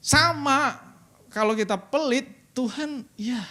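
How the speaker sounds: high, loud warning; preaching shout. Acted. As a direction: hammer the last point home. Closing punchline preaching.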